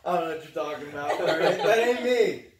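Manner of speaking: loud, laughing fake defensiveness; loud mock-defensive denial, laughing through it. Real, not acted. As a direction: protest your innocence while cracking up. Joking denial amid laughter.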